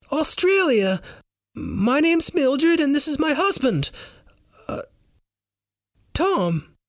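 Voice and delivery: very high, badly faked Australian accent; very non-Australian accent. A narrator's reading. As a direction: introduce yourself haltingly in a failed Australian accent. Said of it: "replies in a very non-Australian accent".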